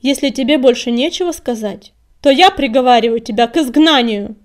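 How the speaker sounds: loud, sharp pronouncement of banishment; stern leader's loud verdict; harsh, unfamiliar voice. A narrator's reading. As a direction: pass sentence harshly and loudly. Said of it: "голос её прозвучал незнакомо и резко".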